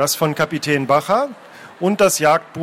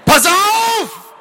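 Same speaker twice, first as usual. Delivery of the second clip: loud, high-pitched yell; shouted warning; 5 dB louder than usual. Acted. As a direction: cry out an urgent warning. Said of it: "schrie Phileason".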